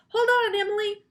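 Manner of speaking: high loud call; shouted. A narrator's reading. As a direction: call out across the pit. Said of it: "Alexia shouted".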